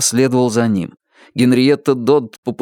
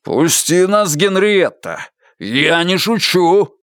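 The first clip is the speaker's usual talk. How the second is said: barely restrained fury; 7 semitones higher, slower than usual. Acted. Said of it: "Его голос дрожал от бешенства".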